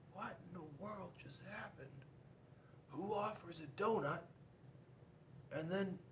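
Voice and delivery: soft muttered disbelief; quiet baffled whale. Acted. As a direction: shrugs off the refusal.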